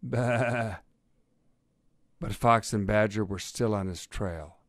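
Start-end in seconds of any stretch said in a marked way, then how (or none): 0.0-0.8 loud quavering baa; sheep bleat. A narrator's reading. Sheep greeting the cat in a field, bleating. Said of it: "greeted by a sheep".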